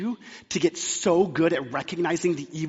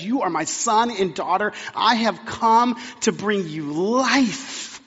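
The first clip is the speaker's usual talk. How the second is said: louder, slow, tender proclamation; Holy Spirit's voice; 5 semitones higher, 6 dB louder, slower than usual. Retold or imitated. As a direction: speak it as a loving promise. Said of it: "the Holy Spirit who says".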